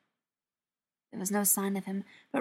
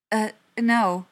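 louder, hesitant, mildly flustered reply; flustered stammer; 8 dB louder than usual. A narrator's reading. Ryan to the store clerk, with a mild stammer. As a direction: stumble into the answer, slightly thrown. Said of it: "Ryan stammered".

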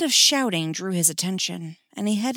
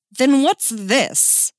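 loud, high, slow challenge; triumphant challenge; 6 semitones higher, 8 dB louder, much slower than usual. Acted. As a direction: present damning evidence theatrically. Producing evidence in mock trial.

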